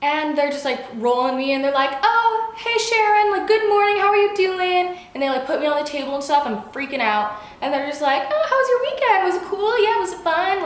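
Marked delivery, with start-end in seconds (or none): 2.0-5.0 high, loud chirpy greeting; cheery coworkers. Retold or imitated. Hospital staff greeting each other at shift start, cheery. 8.3-10.6 very high-pitched chirpy chitchat; joking coworkers. Retold or imitated. Staff chatting while the patient freaks out, mock cheery. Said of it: "laughing, making jokes".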